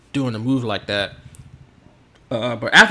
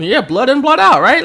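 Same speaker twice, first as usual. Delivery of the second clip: high, louder mock manager voice; imitating Jimmy Hart; 12 semitones higher, 6 dB louder than usual. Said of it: "Jimmy Hart wasn't going around going".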